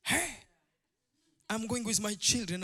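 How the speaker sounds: ordinary talk, nothing marked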